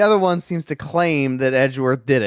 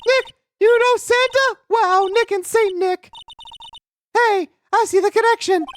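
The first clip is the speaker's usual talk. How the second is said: put-on high girlish sidekick voice; young girl voice; 4 dB louder than usual. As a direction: play the chirpy young assistant. Voices Maya character.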